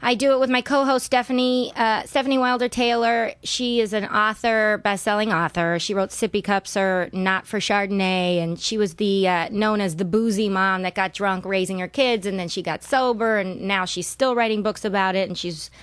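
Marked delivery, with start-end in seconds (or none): none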